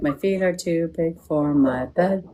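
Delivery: lightly voiced spirit, drawn out and soft; quoted spirit voice. Medium voicing the dead man to the client, slow and soft.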